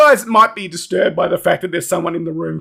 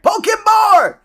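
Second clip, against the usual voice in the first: high-pitched goblin yell; muffled yelling; 14 semitones higher, 3 dB louder, slower than usual. Acted. Goblins heard from the courtyard, muffled high yell. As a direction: yell it high, as if from far away. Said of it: "like, muffled yelling".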